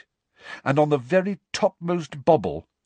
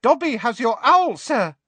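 high squeaky elf in the dark; 14 semitones higher, 5 dB louder than usual. A narrator's reading. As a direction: squeak it out like a tiny house elf. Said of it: "said a squeaky voice".

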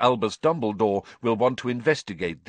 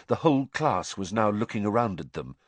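ordinary delivery

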